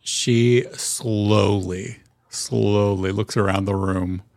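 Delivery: slow, stretched-out words; drawn-out narration. A narrator's reading. Game master describing her slowly looking round, drawn out. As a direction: draw out each word of the slow look.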